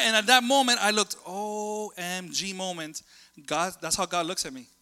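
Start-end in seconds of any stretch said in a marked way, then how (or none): none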